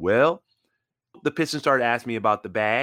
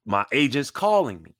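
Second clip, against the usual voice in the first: ordinary delivery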